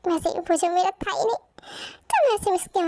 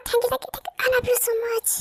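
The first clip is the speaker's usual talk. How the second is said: excited thanks; 5 semitones higher, much slower than usual. Acted. Son thanking parents excitedly.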